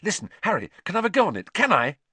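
high, eager pestering; impatient. A narrator's reading. Ron asking for a go on it, impatiently eager. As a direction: plead loudly, itching to have a turn. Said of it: "said Ron impatiently".

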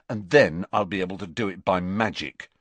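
ordinary delivery, nothing marked

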